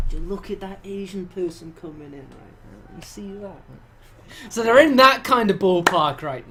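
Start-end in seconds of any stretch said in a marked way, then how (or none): 0.1-3.6 a mildly hushed gossiping aside. Retold or imitated. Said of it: "with a hushed voice".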